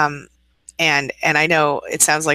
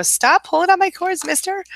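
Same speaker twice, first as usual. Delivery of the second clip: much higher, playful scolding of the kitten; high voice at the cat. Real, not acted. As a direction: tease the cat pulling on the cords. Scolding kitten pulling cords.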